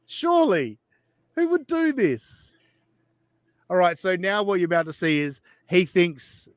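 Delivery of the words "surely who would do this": incredulous. Real, not acted. Incredulous about the clip.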